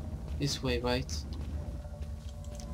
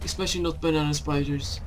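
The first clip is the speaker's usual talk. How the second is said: fast nervous rattle; much faster than usual. Real, not acted. Rattling off spider fact.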